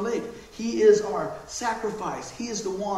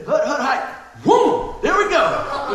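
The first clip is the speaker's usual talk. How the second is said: excited play call; 5 semitones higher, 8 dB louder, slower than usual. Retold or imitated. Sister's football play retold.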